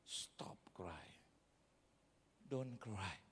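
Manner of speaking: "Stop cry" hushed and low, then "Don't cry"; quiet, low order, "Stop cry"; exclamation-mark command. Shown as a demonstration. Preacher demonstrating Jesus's words, hushed command. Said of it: "Eh, tanda seru gitu".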